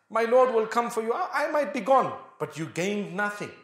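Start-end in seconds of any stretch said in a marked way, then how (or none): none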